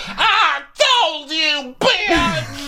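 very high, drawn-out wail of outrage; mock screech. Erica vindicated before the other wives, hammed-up screeching. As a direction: screech it slowly, stretching every word.

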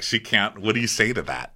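normal talking voice

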